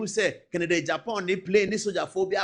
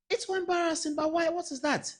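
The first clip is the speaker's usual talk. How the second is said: pitched up high in exasperation; can't believe how embarrassing this is; exasperated disbelief; 11 semitones higher than usual. Real, not acted. Vlogger to viewers about the ministry statement, exasperated and pitched high.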